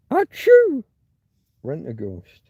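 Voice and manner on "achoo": a shrill, loud, hammed-up achoo; fake sneeze. Streamer turns a chat name into a loud mock sneeze.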